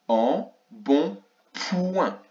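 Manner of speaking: En bon point in three slow, separate syllables; slow sounding out. Shown as a demonstration. Teacher finding ouin in the word, slow sounding out. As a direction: space out En, bon, then stretch point. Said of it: "écrire le son ouin dans ce mot".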